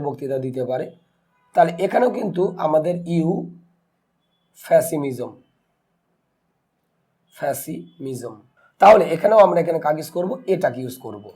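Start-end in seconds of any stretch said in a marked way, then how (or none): 3.2-5.3 ইউফ্যাসিমিজম split after a long ইউ, then a pause; teacher slowly breaking ইউফ্যাসিমিজম into parts; sounded out slowly. Shown as a demonstration. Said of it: "তাহলে আমরা spelling করি". 7.4-8.4 ফ্যাসিমিজম in two slow pieces, ফ্যাসি-মিজম, low and soft; low, soft, slow ফ্যাসি, pause, মিজম; sounded out slowly. Shown as a demonstration. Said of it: "তাহলে আমরা spelling করি".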